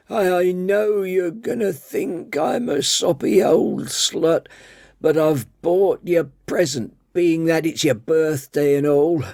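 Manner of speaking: put-on wag a finger like a cranky old tea lady; scolding old woman. Old tea lady scolding Daniel for whinging, high old voice. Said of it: "said Gwyn".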